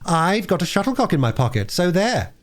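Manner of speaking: put-on high, childish gloating retort; exasperated triumph. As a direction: crow it like a vexed little girl. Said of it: "in a tone of exasperated triumph".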